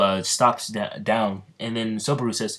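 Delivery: usual speaking voice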